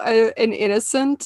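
ordinary delivery

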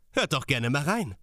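ordinary talk

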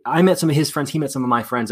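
usual speaking voice

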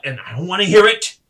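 acted instructor's telling-off; stern cut-off. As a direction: shut down the excuse sharply and loudly. Instructor cuts off an excuse.

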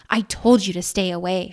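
loud, forceful warning; angry holler. Acted. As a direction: holler it at the intruder. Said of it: "she hollered".

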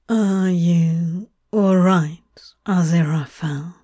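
hesitant, low-pitched check on a friend; quiet concern. Acted. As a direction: ask carefully, voice dropped low. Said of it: "he asked quietly".

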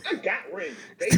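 high, laughing, indignant brag; mock Jordan bragging. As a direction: flaunt the rings at him. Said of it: "And he would be like".

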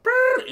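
high loud buzzing "brrr"; squeaky cartoon buzz; mock power noise. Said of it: "He went".

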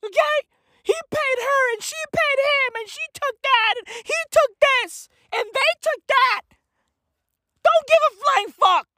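shrill-pitched hammed-up yelling; high manic rant about not caring; high frantic rant. Ranting, voice jumps high.

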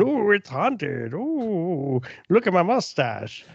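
high, slow, prissy mock Victorian; mocking period drama. Mocking a period film's characters, high and slow. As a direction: ham up a fussy old-timey gent. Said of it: "people sitting in a house going".

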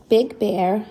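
ordinary delivery, nothing marked

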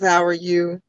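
ordinary delivery

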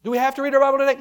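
loud, high-pitched protest; acted-out tantrum question; screaming flesh. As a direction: complain loudly like an unwilling child. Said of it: "our flesh ought to be screaming".

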